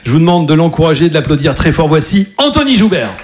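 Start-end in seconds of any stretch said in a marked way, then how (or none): none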